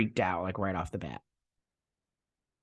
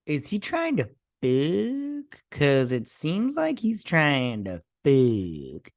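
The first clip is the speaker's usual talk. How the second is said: mock incredulity; 2 semitones higher, much slower than usual. Viewer reacting to a suspected come-on, mock incredulity. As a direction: stretch the last word out in exaggerated suspicion.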